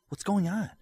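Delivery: ordinary delivery, nothing marked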